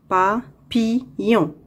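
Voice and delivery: "Papillon" slowly split pa, pi, llon; syllable by syllable. Shown as a demonstration. Teacher locating a sound for pupils, "Papillon" slowly in three parts. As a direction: break "Papillon" into three separate beats. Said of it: "Dans la troisième syllabe".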